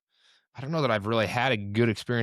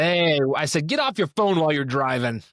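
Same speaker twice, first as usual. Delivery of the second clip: raised, high-pitched telling-off; playful pretend yelling; mock yell; 5 semitones higher, 4 dB louder than usual. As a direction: call out as if scolding a stranger, jokingly. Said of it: "Do you yell at her".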